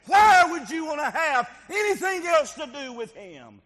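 the devil sneering, building to a loud shout; devil accuser. Acted. Devil mocking a man before God, rising to a shout.

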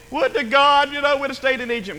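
high-pitched mock lament; mock complaining. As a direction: moan it high, then let it drop.

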